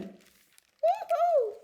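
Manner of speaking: high delighted cheer; excited. Acted. Cheering bear gets in.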